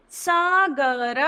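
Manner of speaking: Sagar drawn out slowly, syllable by syllable; slow syllable reading. Shown as a demonstration. Teacher modelling Sagar slowly for children. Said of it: "इन उदाहरण को पढ़ते हैं हम".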